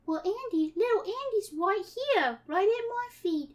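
put-on high, cutesy child voice; eerie little girl Sandy. Sandy welcoming Akira from her chair, high cutesy child. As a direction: play a sweet little girl, slightly off.

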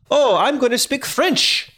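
high-pitched mock French accent; botched French accent. Joking that nobody just wakes up speaking French, botched French accent. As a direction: put on a silly, unconvincing French accent. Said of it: "I changed my accent there".

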